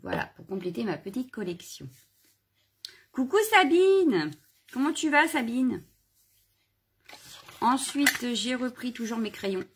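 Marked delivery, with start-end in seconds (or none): 3.1-5.8 high, loud, drawn-out hello; excited greeting. Real, not acted. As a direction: call out the name slowly and cheerfully. Greeting a viewer in chat.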